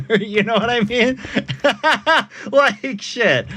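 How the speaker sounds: laughing through the words; cracking up at own joke. Real, not acted. Streamer laughing at own joke as they talk.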